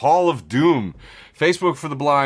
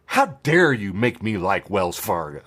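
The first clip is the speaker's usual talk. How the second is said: ordinary talk, nothing marked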